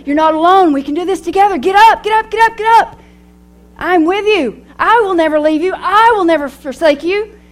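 rising to a loud, high urging call; God urging her up. Acted. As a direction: lift her off the ground like a cheering father.

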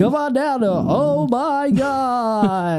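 slow high game show announcer; game show host. Pretend. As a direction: ham up a TV host.

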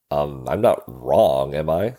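ordinary talk

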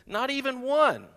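high plaintive storybook Toad; mildly mournful; sad. A narrator's reading. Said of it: "said Toad sadly".

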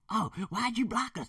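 high, whiny imitation of relatives; mock family complaint. Relatives to him after he left the group, mock accusing. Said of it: "that was gonna be like".

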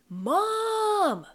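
very high, long drawn-out wail; high, stretched-out cry. A narrator's reading. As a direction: wail for a parent, holding the vowel. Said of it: "I was practically wailing".

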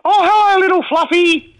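up an octave, cooing to a pet; pet-talk voice. Acted. Caller mimics owners greeting pets, octave-high pet voice. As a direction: go squeaky-high as if greeting your little pet. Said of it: "everyone's voice go up an octave".

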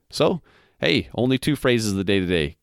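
ordinary delivery

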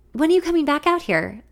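lifted, hopeful question. A narrator's reading. Woman asking when he will return, hopeful. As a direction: ask with eager hope. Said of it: "I ask hopefully".

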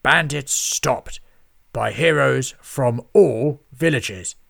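loud, slow proclaiming shout; shouted headline. A narrator's reading. Lord Lloyd shouts the new headline down his speaking tube, loud and slow. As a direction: bellow it down the tube. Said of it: "shouted out the headline".

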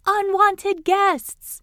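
high, slow crowing scream; rooster scream. Acted. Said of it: "The midnight rooster screamed".

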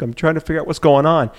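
hint of a baffled animal; lightly voiced puzzled deer. Hunter voices a deer working out what happened, lightly puzzled.

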